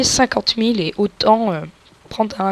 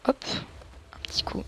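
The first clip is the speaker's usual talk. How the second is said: whispering; 4 semitones lower, 9 dB quieter than usual. Real, not acted. Whispered aside while looting.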